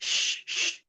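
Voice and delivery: "sh-" as pure voiceless hiss, softly; bare shin sound. Shown as a demonstration. Teacher isolating the letter shin, voiceless "sh". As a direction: let out two short soft "sh" sounds. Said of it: "huruf shin".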